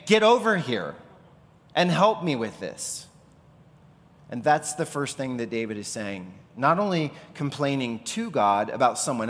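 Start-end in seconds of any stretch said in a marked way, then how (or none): 0.1-3.1 higher, louder call for help; mock urgent call across the room; urgent demand. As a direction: snap at them to come help. Demanding help with heavy load.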